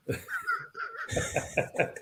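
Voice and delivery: high, quiet, breath-only wheeze of laughter; helpless laugh. Real, not acted. Host stuck before a hard word in a fan's question, wheezing with laughter. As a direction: crack up helplessly, nearly soundless.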